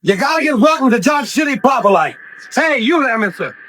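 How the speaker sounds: put-on drunken cowboy shouting to the crowd; rowdy calling out.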